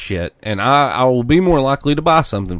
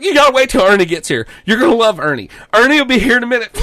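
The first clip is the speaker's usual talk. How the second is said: much higher, louder, fast bro voice; excited bro; 11 semitones higher, 5 dB louder, faster than usual. Retold or imitated. Quoting the clerk, high fast gushing bro voice. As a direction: gush about Ernie, rushing. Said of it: "He's like".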